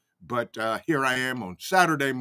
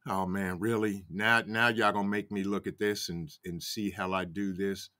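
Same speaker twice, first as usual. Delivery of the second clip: dropped low and much softer, a put-upon mumble; mock weary groan; 7 semitones lower, 7 dB quieter than usual. Streamer imitating his own reluctance at the next story, low and soft. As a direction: grumble it to yourself like a chore. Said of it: "I was like".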